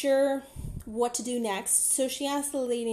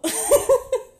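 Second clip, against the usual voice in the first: loud high laugh; 13 semitones higher, 12 dB louder than usual. Real, not acted. Laughing at agent's "oh no.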